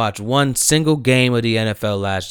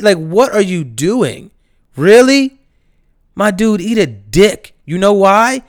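loud, higher furious ranting; angry rant; 8 semitones higher, 6 dB louder than usual. Real, not acted. Vlogger chewing out the bus driver, loud and furious. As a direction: chew him out for the U-turn.

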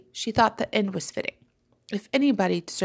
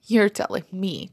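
plain reading voice

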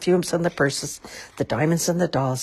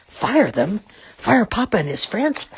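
raised, incredulous questioning; shocked disbelief; 7 semitones higher, 3 dB louder than usual. A narrator's reading. Millie hears Papa may be fired, shocked disbelief. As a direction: voice climbing in disbelief. Millie reacts to firing threat.

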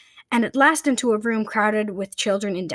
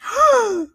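sharp high gasp of shock; a sudden startled intake of breath; 13 semitones higher, 4 dB louder than usual. Acted. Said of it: "The children gasped".

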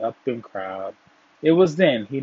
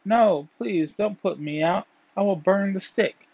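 mildly urgent, higher-pitched plea; pleading cry; 7 semitones higher than usual. A narrator's reading. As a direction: cry out in a high pleading voice, without real shouting. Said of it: "the fire cried out".

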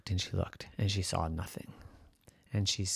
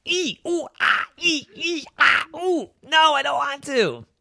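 very high, loud squeals into a whiny refusal; squealing reluctance; 7 dB louder than usual. Acted. Captain balks at the dragon's tail, squealing mock whine. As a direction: squeal and whimper theatrically, then refuse up high.

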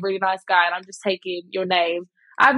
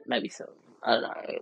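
hushed aside; 10 semitones lower, 9 dB quieter than usual. Real, not acted. Unsure aside while listing products.

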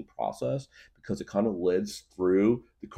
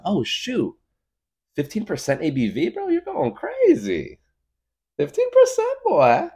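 shocked exclaim; 14 semitones higher, 5 dB louder than usual. Retold or imitated. Shocked at strong beer.

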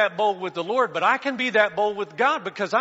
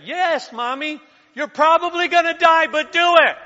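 higher, louder mock child voice; little daughter; 9 semitones higher, 9 dB louder than usual. Little daughter cheering her mother from below, mock child voice. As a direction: play the eager little girl. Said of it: "the little daughter say".

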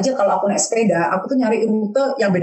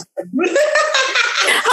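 sudden high laugh, bright and free; high burst of laughter. Real, not acted. Joke about romantic rain walk.

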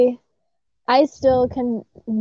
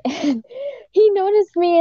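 usual speaking voice

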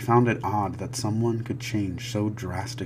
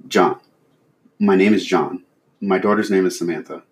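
shaken; 12 dB louder than usual. Acted. Shaken father answers.

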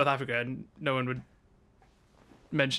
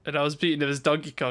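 ordinary talk, nothing marked

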